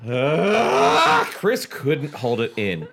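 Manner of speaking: ordinary talk, nothing marked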